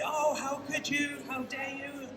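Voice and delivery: high, slow mock outrage; hammed-up indignant falsetto; mock outraged friend. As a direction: squeal it like a wounded friend. Imagined outraged friend on phone.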